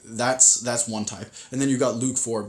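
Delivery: ordinary talk, nothing marked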